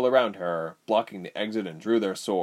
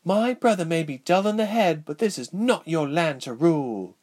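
defiant princess; 8 semitones higher, 3 dB louder than usual. A narrator's reading. Princess defies traitor.

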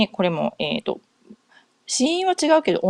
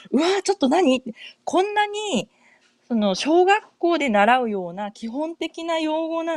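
high-pitched, incredulous inner voice; astonished at oneself, high and drawn out; acted bafflement; 9 semitones higher than usual. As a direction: re-enact stunned disbelief, pitch lifted high. Acts out her baffled inner voice.

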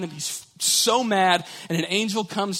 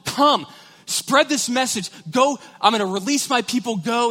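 God calling; 7 semitones higher, 5 dB louder than usual. Acted. God voiced calling Moses.

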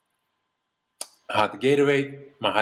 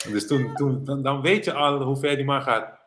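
usual speaking voice

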